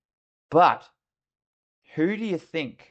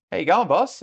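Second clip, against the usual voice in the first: higher, louder imitated greeting; soldier's casual greeting; 7 semitones higher, 6 dB louder than usual. Soldier coming up to his officer, casual hail. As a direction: call it out to the boss.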